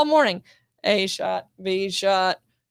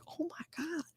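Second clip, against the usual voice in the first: soft whispered oh my God; whispered gasp; 6 semitones higher, 20 dB quieter than usual. Retold or imitated. Hearing the cops ask about the body, whispered. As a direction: breathe it out under your breath.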